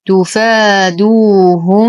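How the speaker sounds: تفادوهم recited slowly with a two-beat long ا; slow tajweed recitation. Shown as a demonstration. Teacher recites the word for students, slow measured chant. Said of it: "نتم ضمة الدال".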